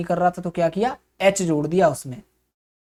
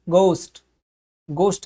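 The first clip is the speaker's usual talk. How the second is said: गोस्ट in an American accent with a plain o; American गोस्ट, open o and hard g. Shown as a demonstration. Teacher demonstrating the American गोस्ट. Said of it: "American ... हम बोलेंगे".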